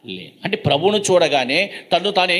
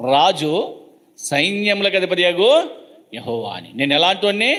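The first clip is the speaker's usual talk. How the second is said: ordinary talk, nothing marked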